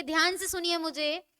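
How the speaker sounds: ordinary talk, nothing marked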